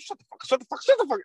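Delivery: high clipped angry cut-off; angry coach. Retold or imitated. Coach cutting off his son's excuses, high angry. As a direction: shut him down mid-excuse. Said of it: "Coach Vassos goes".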